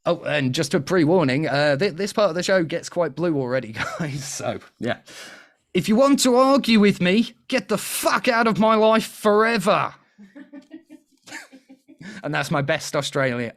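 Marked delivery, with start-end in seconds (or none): 5.7-9.9 high, loud, bad Australian accent. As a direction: argue forcefully in a put-on Australian accent. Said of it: "Australian accent, so don't expect to get better".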